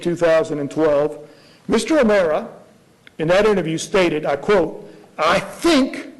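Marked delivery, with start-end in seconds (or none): 5.2-6.1 quoting a lawyer, leaning on the word think; stress on think. Shown as a demonstration. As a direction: think stressed and pitched up with a rising tune. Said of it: "I want to emphasize the word think".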